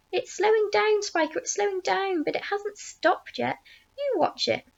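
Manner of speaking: high-pitched, urgent insisting; excited insistence. A narrator's reading. Aunt correcting her sister about the peach, high excited insistence.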